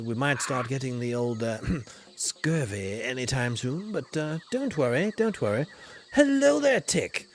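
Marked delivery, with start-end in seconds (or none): 6.1-7.3 loud, high sing-song hello; hearty greeting. Acted. Explorer hails Child Crone, loud and high. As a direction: call out a big cheery welcome.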